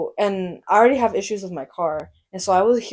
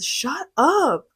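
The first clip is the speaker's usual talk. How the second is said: higher-pitched, half-held-back yell; retold yell; 8 semitones higher than usual. Driver fed up with noisy passengers, retold yell. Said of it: "like, yelled at her".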